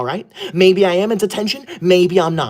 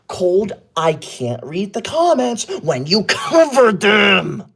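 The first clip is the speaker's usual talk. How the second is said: drawn-out exasperated shout; a slow, fed-up shout at a viewer; shout at gifter; 3 semitones higher, much slower than usual. Real, not acted. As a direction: call out slowly at the gifter who covers the chat. Yelling at a gifter covering chat.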